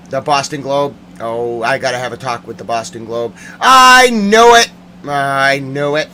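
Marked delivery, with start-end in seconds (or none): 3.6-4.7 high, loud, drawn-out cry; indignant yell. Real, not acted. Insisting to listeners on being right, yelling. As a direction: yell it slowly in vindication. Vindicated yell, then subdued repeat.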